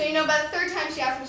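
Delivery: ordinary talk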